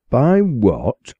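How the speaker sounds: mock-stern growl at the boy. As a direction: snap the question back at the boy. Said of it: "growled the parson".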